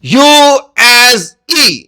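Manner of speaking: یو ایز ای said very slowly, letter by letter; teacher stating the spelling rule; slow spelled-out rule. Shown as a demonstration. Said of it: "جب ای کی آواز دیتا ہے".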